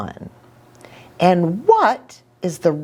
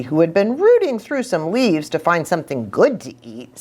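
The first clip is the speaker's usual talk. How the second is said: ordinary delivery, nothing marked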